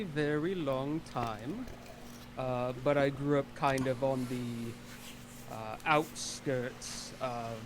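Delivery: slowed, halting recollection. Acted. Character slowly recalling childhood to a host. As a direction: drag it out while remembering.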